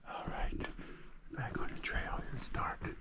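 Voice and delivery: hushed whisper in a dark cave; cave echo. Real, not acted. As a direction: whisper so as not to disturb.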